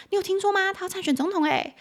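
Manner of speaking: high, eager gossip tone; excited gossip. Shown as a demonstration. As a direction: tell it like juicy news. Gossipy chat example line.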